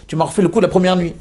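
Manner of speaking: exasperated husband shouting a complaint; angry reproach. Acted. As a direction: confront her, upset and betrayed. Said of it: "il est très énervé".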